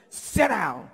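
loud, curt imitated order; abrupt command. Head physician ordering the candidate to her seat, curtly. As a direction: bark it as a brusque interviewer. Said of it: "just like that".

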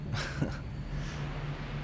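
short, breathy scoff of a laugh. Acted. Drake scoffs at Eddie's threat, breathy laugh.

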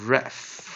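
"ref" sounded slowly as one syllable; closed syllable. Shown as a demonstration. Teacher reading the first syllable, "ref" slowly sounded out. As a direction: draw out "ref" to its final consonant. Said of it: "所以念ref".

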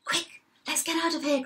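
high, hurried cry of alarm; mildly panicked storybook cry; urgent cry. A narrator's reading. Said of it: "cried the biggest billy goat".

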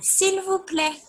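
put-on teacher modelling S'il vous plaît for children to repeat; French s'il vous plaît, high. Shown as a demonstration. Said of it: "Let's try again".

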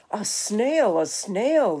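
loud, swooping cry of shock; alarmed teacher. A narrator's reading. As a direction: exclaim it in storybook horror. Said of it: "the teacher turns pale".

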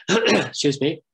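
normal talking voice

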